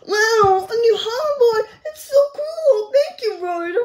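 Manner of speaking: high, loud gushing in play; overjoyed. Pretend. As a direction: gush like a pup thrilled with a present. Pup receives hoverboard in play.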